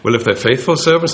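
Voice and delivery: ordinary talk, nothing marked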